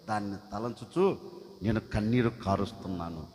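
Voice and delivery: low, soft and sorrowful reply; grieving servant. Acted. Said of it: "అప్పుడు అతను అంటాడు".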